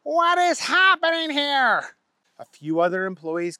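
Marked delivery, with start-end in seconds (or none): 0.0-1.9 high, slow, booming boss voice; a startled boss demanding answers; high shocked boss voice, drawn out; factory owner. A narrator's reading. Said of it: "the factory owner came out and said".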